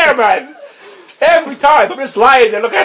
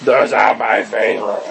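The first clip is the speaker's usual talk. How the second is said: a weak, lower try at the catchphrase; slower, lower, half-voiced catchphrase; failed impression; 9 semitones lower, slower than usual. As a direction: attempt the bit but the voice gives out. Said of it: "I can't do it. My voice is gone".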